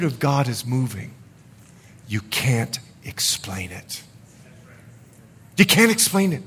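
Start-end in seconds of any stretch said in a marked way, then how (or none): 2.1-4.0 slow, low and very soft; hushed awe. Real, not acted. Repeats line for effect, hushed first. 5.6-6.4 higher-pitched, brisker repeat at speaking volume; emphatic, pitched up; raised insistence. Real, not acted. Same line repeated, voice raised.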